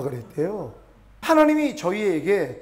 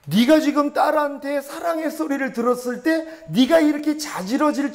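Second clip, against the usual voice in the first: raised, stirring voice of the Lord; fervent voicing of God; 6 semitones higher than usual. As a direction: proclaim God's joy with fire. Preacher voices God's answer.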